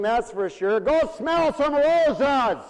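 high, loud and slow on smell some roses; a hammed-up comeback, stretched out; imagined sassy retort. Preacher imagining a retort to the harsh clerk, mock sassy. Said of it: "I felt like saying".

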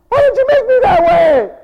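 very high loud complaining wail; whining sinner. Retold or imitated. Sinner complaining to God, very high wail.

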